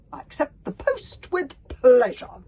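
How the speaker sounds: loud, high, slow and emphatic; prompt acceptance. A narrator's reading. Humongous taking the bodyguard post, grandly eager. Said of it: "said Humongous immediately".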